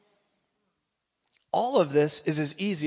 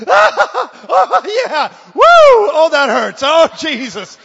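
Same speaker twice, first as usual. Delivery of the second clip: very high, very loud pained hollering; mock pain shouts; 13 semitones higher, 18 dB louder, much slower than usual. Preacher hollering at his back pain at night, loud and high. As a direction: yell through the pain, half laughing. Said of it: "I was mocking the pain".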